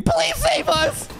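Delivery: high, drawn-out cry for help; mock panicked scream. Viewer voicing people running from the dragon, mock scream. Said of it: "screaming, saying".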